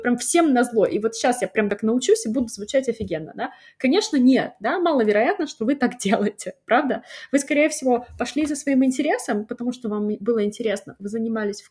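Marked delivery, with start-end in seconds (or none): none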